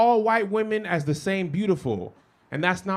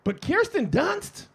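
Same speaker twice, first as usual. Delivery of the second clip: incredulous; 8 semitones higher, much slower than usual. Real, not acted. Incredulous challenge to audience.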